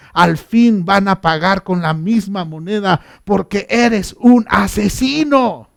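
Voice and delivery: loud, forceful angry tirade; shouted accusation. Retold or imitated. Simei accuses King David of bloodshed, shouting angrily. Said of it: "le gritó a David".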